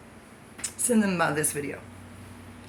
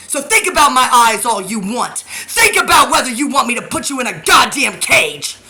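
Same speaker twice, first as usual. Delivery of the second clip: louder and higher, spitting each curse; furious yelling rant; 4 semitones higher, 8 dB louder than usual. Real, not acted. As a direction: rage at them with everything you've got.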